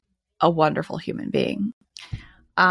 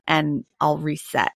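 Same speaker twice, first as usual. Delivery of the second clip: ordinary talk, nothing marked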